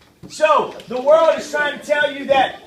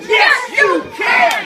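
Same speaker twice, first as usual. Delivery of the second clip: much higher and louder, slow and firm; "Yes, you can." belted out with conviction; 9 semitones higher, 5 dB louder, slower than usual. Shown as a demonstration. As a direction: declare it like you mean it. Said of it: "Now say it with conviction".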